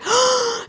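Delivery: high mock gasp of panic; panicked gasp. Reacting to her friend on FaceTime, acted panicked gasp. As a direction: suck in a dramatic, high gasp.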